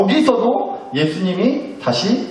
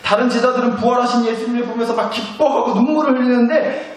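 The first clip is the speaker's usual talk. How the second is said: ordinary delivery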